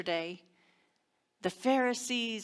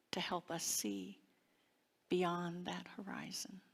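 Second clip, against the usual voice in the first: softer whisper, drawing them in; 5 dB quieter than usual. Real, not acted. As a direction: let the line fade into a whisper. Sermon closes on a hush.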